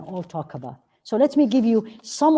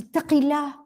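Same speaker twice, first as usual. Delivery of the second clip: scolding reproach; 6 semitones higher, much faster than usual. Retold or imitated. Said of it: "we look at people and say".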